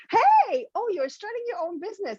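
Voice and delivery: very high fake-enthusiastic gush; put-on squeal of interest, quickly softening; mock-friendly greeting.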